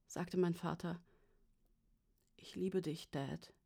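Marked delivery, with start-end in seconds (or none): 2.4-3.5 soft, hushed declaration of love; tender. A narrator's reading. Worried daughter to her father on the phone, tender. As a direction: say it quietly and gently.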